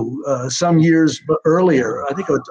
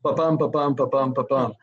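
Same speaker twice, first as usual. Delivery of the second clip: "Ba-bum" tapped out as da-DUM, weight on "bum"; iambic rhythm; 3 semitones lower, 6 dB quieter than usual. Shown as a demonstration. Writer showing the interviewer his script's meter, rhythmic da-DUM beats. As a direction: beat out the iambic meter, "ba" light, "bum" strong. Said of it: "all the dialogue also is in iambic pentameter".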